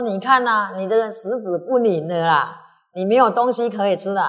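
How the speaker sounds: loud teasing jab; mocking taunt. King mocking the retainer at the feast, loud taunt. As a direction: taunt him that there's nothing for him.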